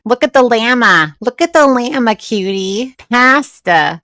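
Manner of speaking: high, loud, nasal mimicry of Chantal; mock her nasally accent at full volume; nasally mock impersonation. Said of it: "God, that nasally".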